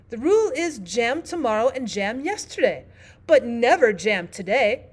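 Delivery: loud, firm storybook Queen; imperious Queen. Acted. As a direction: lay down the rule as Queen.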